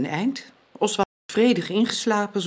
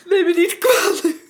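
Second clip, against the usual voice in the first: higher, trembling, weepy voice; tearful breakdown; 7 semitones higher than usual. Acted. Widow breaking down in front of Max, tearful. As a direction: let the grief break through.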